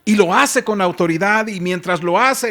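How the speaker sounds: ordinary talk, nothing marked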